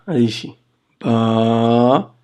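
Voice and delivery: ordinary talk, nothing marked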